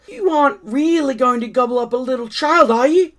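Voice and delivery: high, frightened monkey; scared monkey. Acted. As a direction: plead in fear with the crocodile. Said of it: "began to shake all over".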